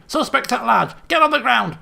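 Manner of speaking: loud, high barked command; hammed-up megaphone cop yelling orders; mock police shout. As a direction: bellow it like a frantic cop.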